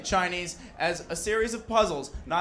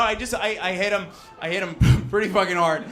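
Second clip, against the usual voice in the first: ordinary talk, nothing marked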